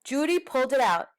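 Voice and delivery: ordinary delivery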